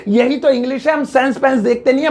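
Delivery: ordinary talk, nothing marked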